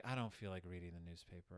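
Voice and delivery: low, soft, listless mock voice; quietly voiced apathy, pitched well down; depressed Mama. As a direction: imitate a mother too down to bother. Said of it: "Is Mama depressed?".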